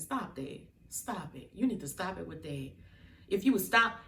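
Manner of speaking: normal talking voice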